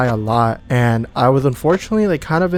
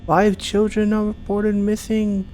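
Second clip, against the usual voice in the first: higher, slow, hammed-up mourning; mock dramatic lament; 6 semitones higher, 4 dB quieter, slower than usual. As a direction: ham up a tragic announcement, slowly. Mock mourning game screens.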